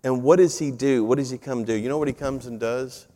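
ordinary delivery

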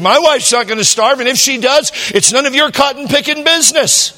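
high, loud huffy retort; hammed-up offended friend; retold indignation. Friend telling the pastor to butt out, high and huffy.

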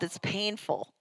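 ordinary talk, nothing marked

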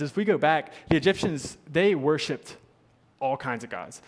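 ordinary delivery

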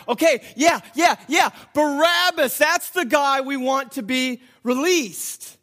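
loud, high excited agreement; hyped-up crowd. Acted. Everybody getting all hyped for Barabbas, excited shouting. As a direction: yell it like a crowd catching fire. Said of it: "getting all hyped".

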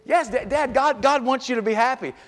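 a hint of a bubbly daughter; cheerful daughter. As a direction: chirp it like a cheerful kid. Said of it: "it's all rainbows".

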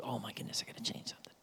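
whispered in alarm; shocked whisper. Acted. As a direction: breathe it out in a frightened whisper. Shocked patient realizing.

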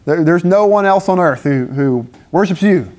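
a hint of despairing prophet, half laughing; Elijah crying out. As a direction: Elijah on the run pleading with God, mildly hammed up. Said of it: "he cries out to God. He's like".

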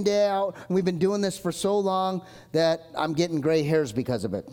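ordinary talk, nothing marked

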